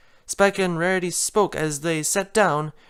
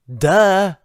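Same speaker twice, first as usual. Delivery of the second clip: louder, mocking duh; exclaimed duh; 3 semitones higher, 7 dB louder than usual. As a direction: throw it back at her. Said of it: "Twilight exclaimed".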